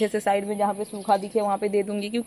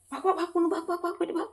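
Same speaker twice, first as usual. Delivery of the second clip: quiet urging; 6 semitones higher, 10 dB quieter, faster than usual. Real, not acted. Quietly urging bird to run.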